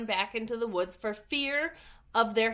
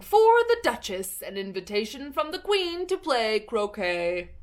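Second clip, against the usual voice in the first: fish footman voice; 5 dB louder than usual. A narrator's reading. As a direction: proclaim it like a puffed-up servant.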